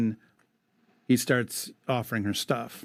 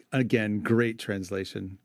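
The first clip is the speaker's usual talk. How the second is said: ordinary delivery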